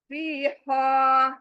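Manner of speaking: फीहा with both vowels drawn out; long vowels. Shown as a demonstration. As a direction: stretch the ee and the aa in फीहा. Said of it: "तो ये भी खींचना है".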